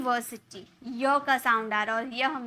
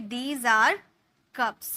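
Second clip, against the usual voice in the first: slow clear model; 3 semitones lower, much slower than usual. Shown as a demonstration. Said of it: "हम बोलेंगे".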